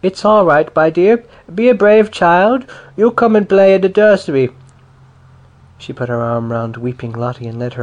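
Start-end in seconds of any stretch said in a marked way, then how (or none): 0.0-4.5 higher, louder soothing voice; comforting big neighbour. A narrator's reading. Neighbour comforts the crying little girl, loud and soothing. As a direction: coax the weeping child along.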